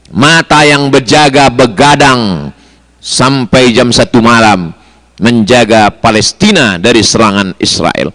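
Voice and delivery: normal talking voice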